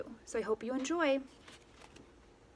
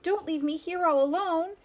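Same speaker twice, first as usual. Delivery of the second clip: high, louder frightened plea; pleading cry; 7 semitones higher, 4 dB louder than usual. A narrator's reading. Sheep begs the others not to leave her, frightened. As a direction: beg not to be left. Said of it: "cried Sheep".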